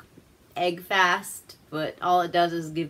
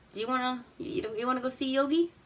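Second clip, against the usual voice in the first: put-on old man in wheelchair; 6 semitones higher, 4 dB quieter, faster than usual. Wheelchair guy invites him.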